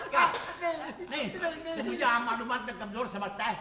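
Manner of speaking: ordinary talk, nothing marked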